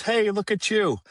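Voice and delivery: high, bright greeting of a chirpy nurse; cheery nurse. Retold or imitated. Nurse greeting the newcomer at detox, mock high and cheery. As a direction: welcome him in like a chipper little nurse.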